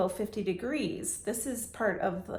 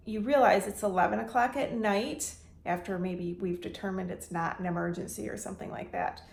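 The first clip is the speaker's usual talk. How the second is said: ordinary delivery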